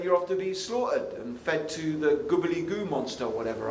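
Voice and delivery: ordinary delivery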